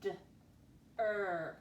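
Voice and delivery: "D-er" as short duh, then held er; sounding out. Shown as a demonstration. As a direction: separate d from a long er in "D-er". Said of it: "Second syllable".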